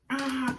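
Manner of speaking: high-pitched acted-out "ah!"; mock convulsing scream. Reviewer acting out her convulsing on the ground, mock scream. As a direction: imitate her fit with a high cry.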